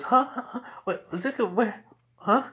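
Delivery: high, flustered stammer; jolted into confused little questions; acted-out startled confusion. Startled character jumps out.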